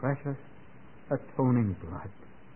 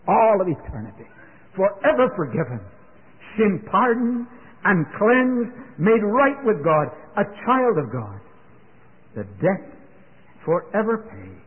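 preaching at full force; 8 semitones higher, 5 dB louder than usual. Acted. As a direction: lift it up to the rafters, slowing on sin pardoned. Preacher builds to climax.

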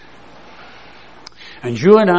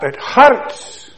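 loud cry of it hurts; 10 semitones higher, 5 dB louder than usual. Real, not acted. Preacher echoes girl's pain.

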